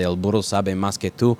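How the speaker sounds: halting gringo Spanish; clumsy Spanish. Shown as a demonstration. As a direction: sound out the Spanish carefully. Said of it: "took me about seven years to be able to say".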